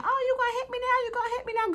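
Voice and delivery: high, fast mocking taunt; imitating her, voice jumped way up and quick. Retelling T's taunt at Scotty, high fast mocking imitation. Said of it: "Even when she was saying".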